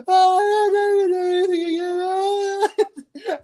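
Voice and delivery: put-on high, slow, sobbing wail of a scared boy; child wailing after falling to the ground crying; mock wailing child. Son falls crying in retelling.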